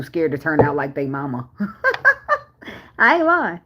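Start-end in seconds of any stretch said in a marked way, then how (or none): 1.5-3.6 bursting into high laughter. Real, not acted. Laughing at parents, high and giggly. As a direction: laugh high and loud, then insist through it.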